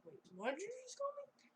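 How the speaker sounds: high-pitched mock outrage; shocked disbelief. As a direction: voice leaps up, acting appalled. Acted shock at a nickname.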